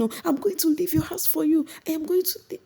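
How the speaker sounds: very high mock yell; high-pitched retold outburst; mock shouting. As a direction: imitate her shrieking threat to leave. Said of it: "she started shouting".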